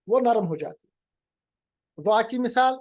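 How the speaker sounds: ordinary delivery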